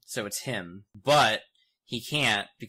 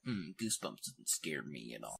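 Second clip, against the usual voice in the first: put-on low, hushed, unimpressed kid voice; imitating his younger self, low and soft; tough little kid; 7 semitones lower, 11 dB quieter than usual. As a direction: play a kid shrugging off the scares. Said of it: "maybe I was being a tough little kid".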